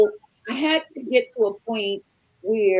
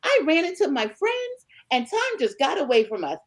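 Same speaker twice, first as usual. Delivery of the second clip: much higher, sugary innocent tone; mock sweet innocence; 7 semitones higher than usual. Wife turning husband's excuse back on him, mock sweet. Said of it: "I said".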